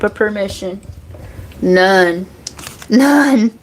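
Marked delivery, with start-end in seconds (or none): 2.9-3.6 emphatic repeat. Real, not acted. To spirits before bed, a high defiant final none. Telling spirits they have no permission.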